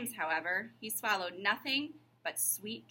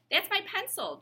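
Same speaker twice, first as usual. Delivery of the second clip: put-on much higher boy voice, earnest; young John; 11 semitones higher, 4 dB louder than usual. John explains to his teacher, higher earnest boy. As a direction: pitch up into an earnest small boy.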